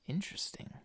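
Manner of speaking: hushed breathy interesting; whispered. Real, not acted. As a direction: breathe the word out softly. Drawn-out creepy texture remark.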